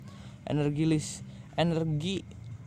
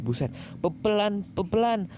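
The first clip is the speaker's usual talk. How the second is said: excited outburst; 7 semitones higher than usual. Real, not acted. Reader reacts to Chase running fast.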